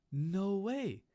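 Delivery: loud, high exaggerated disbelief; mock amazement. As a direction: play shock at the obvious. Sarcastic amazement at obvious theft.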